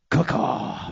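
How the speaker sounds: breathy voiceless raven croak; raspy raven caw. Pretend. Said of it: "that better? ... more intimidating?".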